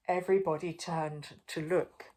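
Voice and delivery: plain reading voice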